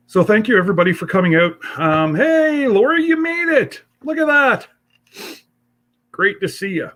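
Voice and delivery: high, bright welcome to viewers; excited greeting. Real, not acted. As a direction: greet them high and happy. Greeting viewers joining the stream.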